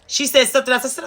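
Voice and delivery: usual speaking voice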